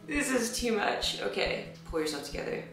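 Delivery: snotty crying. Real, not acted. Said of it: "I'm gonna cry even harder".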